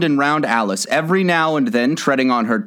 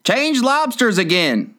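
high, slowed character yell; excited creature yelling in a storybook; mock yell; 10 semitones higher than usual. A narrator's reading. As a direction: call it out high, without real volume. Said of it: "yelled the Griffin at the top".

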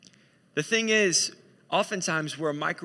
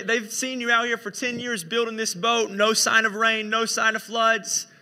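higher, louder exasperated wife; hammed-up wifely grievance, pitched up; nagging wife; 4 semitones higher, 5 dB louder than usual. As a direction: complain to your husband about the neighbours. Acted wife complains to Noah.

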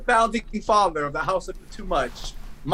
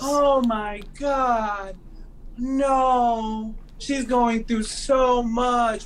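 high, very slow fussing housewife; mock sympathy; 5 semitones higher, much slower than usual. Co-host mimicking rich women pitying Erica, high drawn-out mock sympathy. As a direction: draw out exaggerated concern, high and slow.

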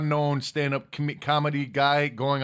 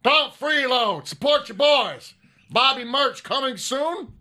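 Steve Austin voice; 8 semitones higher, 4 dB louder than usual. Shown as a demonstration. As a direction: yell it like Stone Cold. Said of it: "should be saying that like Steve Austin".

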